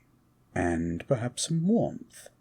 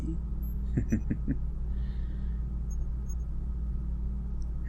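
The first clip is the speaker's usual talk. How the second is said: soft breathy chuckle; 3 semitones higher, 6 dB quieter than usual. Acted. Amused by his date's reply, a soft chuckle. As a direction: chuckle softly to yourself, charmed.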